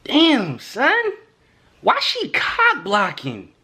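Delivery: high, whiny complaining inner voice; exasperated. Retold or imitated. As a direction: gripe to yourself, fed up. Frustrated inner thought about the mom.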